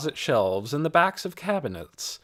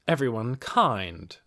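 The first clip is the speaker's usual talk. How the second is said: plain reading voice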